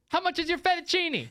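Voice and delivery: high, projected question across the street; calling out. Retold or imitated. Diner asking across the street about a dish, calling out. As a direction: imitate yelling to someone far off.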